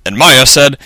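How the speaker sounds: ordinary delivery, nothing marked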